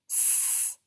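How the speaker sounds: S-P-R-E-D as separate letter sounds, long gaps; tapped sound by sound. Shown as a demonstration. Teacher tapping out spread, S-P-R-E-D sounded in pieces. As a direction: sound out S-P-R-E-D one isolated sound at a time. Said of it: "Let's tap it".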